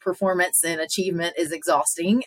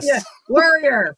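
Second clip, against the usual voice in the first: slow, high, loud Southern "warrior"; exaggerated Southern drawl; Southern accent; 7 semitones higher, 6 dB louder than usual. Shown as a demonstration. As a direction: stretch out the word with a Southern twang. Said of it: "Southern accent".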